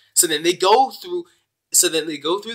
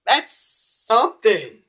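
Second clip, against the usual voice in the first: sarcastic remark; 8 semitones higher, much slower than usual. Real, not acted. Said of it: "sarcastic repeat of comment".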